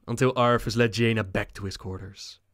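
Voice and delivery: usual speaking voice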